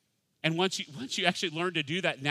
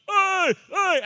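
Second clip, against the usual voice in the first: high, loud mock schoolboy holler; whooped high, much louder; excited boys' greeting; 10 dB louder than usual. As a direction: imitate boys hailing each other excitedly. Boys greeting after summer break.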